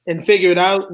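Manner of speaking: usual speaking voice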